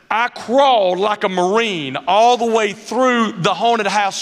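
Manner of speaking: ordinary talk, nothing marked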